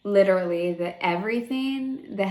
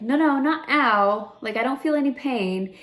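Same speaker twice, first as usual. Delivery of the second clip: higher and louder, insisting it isn't pain; urgent correction; 6 semitones higher, 4 dB louder than usual. Retold or imitated. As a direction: hurriedly set the surgeon straight, pitched up. Retold correcting surgeon after "ow.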